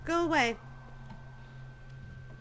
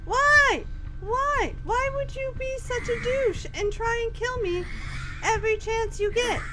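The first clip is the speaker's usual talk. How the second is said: angry shouting; 10 semitones higher, 5 dB louder than usual. Real, not acted. Screaming at attacking child.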